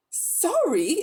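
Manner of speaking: high incredulous rise; Diggory, indignant, exclaiming at Polly; indignant exclamation. A narrator's reading. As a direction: throw the word back at her. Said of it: "exclaimed Diggory".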